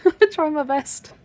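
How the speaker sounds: slow, amused and laughing. Real, not acted. Laughs at compliment on talking.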